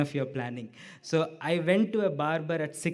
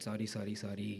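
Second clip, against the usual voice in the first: low, soft, sheepish sorry; subdued apology in a lowered voice; retold apology; 5 semitones lower, 10 dB quieter, faster than usual. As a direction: imitate a meek apology, much quieter. Barber's retold apology after blunder.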